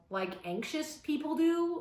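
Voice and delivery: ordinary delivery, nothing marked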